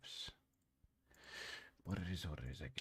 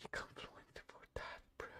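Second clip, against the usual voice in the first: whispered aside; 14 dB quieter than usual. Real, not acted. Muttered trailing aside.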